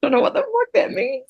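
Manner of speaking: thinking it, utterly confused; baffled. Retold or imitated. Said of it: "and I was like".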